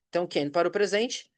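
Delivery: ordinary talk, nothing marked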